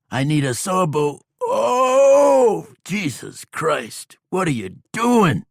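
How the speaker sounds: high, loud panicky cry; panicking injured man, pitched high and loud; frightened outlaw. A narrator's reading. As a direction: yelp it out in terror. Bob panics under bounty hunter.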